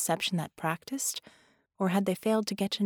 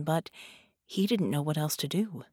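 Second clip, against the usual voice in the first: ordinary delivery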